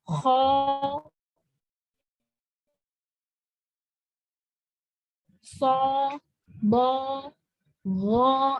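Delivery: dark, full vowels on each of "Kho, so, bo, go"; thick letters. Shown as a demonstration. Teacher demonstrating isti'la letters, thick sound. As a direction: raise the tongue root for heavy "Kho, so, bo, go". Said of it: "kalau kita bilang mungkin huruf tebal".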